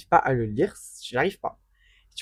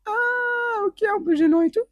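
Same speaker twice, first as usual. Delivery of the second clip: very high, strained embarrassed squeak; awkward squeaky voice; slower than usual. Retold or imitated. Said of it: "Je suis en mode".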